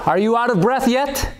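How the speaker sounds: normal talking voice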